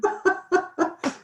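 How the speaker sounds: high burst of laughter. Real, not acted.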